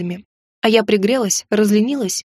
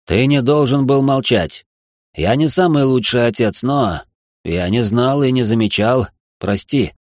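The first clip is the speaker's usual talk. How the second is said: much lower, slow sincere apology; low, earnest fatherly regret; deep remorseful father; 11 semitones lower, slower than usual. Acted. Marquis apologising.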